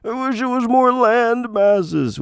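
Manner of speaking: high, slowed whine of disappointment; hammed-up complaint; mock dismayed person. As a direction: pretend to mourn the land masses. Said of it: "No one's like".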